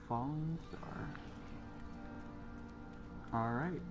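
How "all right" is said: ordinary talk, nothing marked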